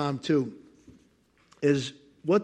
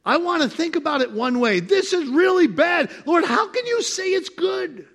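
high, loud shouted protest; distressed believer; 10 semitones higher, 4 dB louder than usual. Pretend. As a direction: shout your complaint at God. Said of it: "arguing with God".

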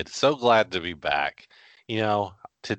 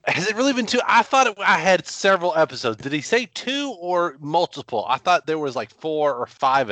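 high-pitched, loud disbelief; real flustered pleading, pitched way up; flustered protest; 10 semitones higher, 4 dB louder than usual. Real, not acted. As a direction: protest in surprise at being caught out. Caught out on overdue homework assignment.

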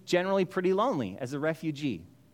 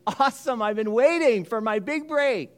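mock eager Moses; 6 semitones higher, 4 dB louder than usual. Mock eager Moses, then denied.

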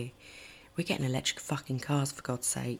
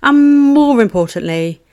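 loud, high announcer intro; big upbeat show opener; podcast intro; 6 semitones higher, 16 dB louder than usual. Acted.

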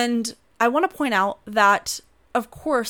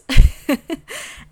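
loud laugh; 6 semitones higher, 12 dB louder than usual. Real, not acted. Laughs at herself.

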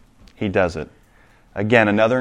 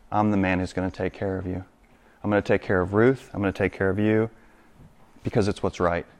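softer, sincere vow to provide; tender promise; 2 semitones lower, 7 dB quieter than usual. Acted. Boaz promising Ruth and Naomi care, soft and sincere. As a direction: lay it down gently as a pledge.

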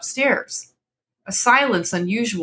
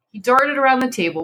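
usual speaking voice

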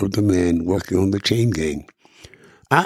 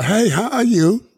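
bright, much higher hail across the street; friendly neighbour greeting; 13 semitones higher than usual. Retold or imitated. Man greeting folks in the community, high cheery hail. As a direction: call out a cheery hello to a neighbour. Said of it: "You would go into a community and say".

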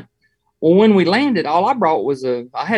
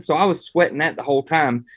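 ordinary delivery

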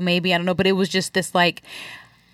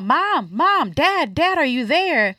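high, sing-out calls at moderate volume; mock yell for parents, pitch swooping up and down; imitated child yelling out; 7 semitones higher, slower than usual. Child still calling out for parents, high mock yell. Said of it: "still yelling out".

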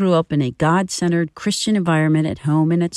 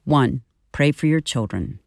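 normal talking voice

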